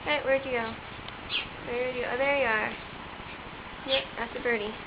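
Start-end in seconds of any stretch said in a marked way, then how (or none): none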